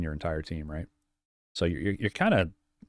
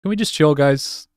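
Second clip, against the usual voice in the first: raised, pleading voice; exasperated plea; 7 semitones higher, 8 dB louder than usual. Real, not acted. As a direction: urge chat to calm down. Streamer calming angry chat.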